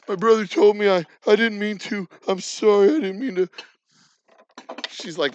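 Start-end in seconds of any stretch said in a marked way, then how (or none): 0.1-3.5 high, loud mock-tearful pleading; mock bawling. Younger self apologizing to brother's girlfriend, mock bawling. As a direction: plead like a crying kid, playing it up. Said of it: "I called her up just bawling".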